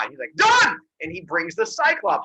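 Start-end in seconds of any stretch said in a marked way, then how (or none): 0.4-0.8 very high, loud exclamation; excited agreement. Retold or imitated. Zeus accepts the Cyclops onto his team, delighted shout. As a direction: seal the deal with a yelp.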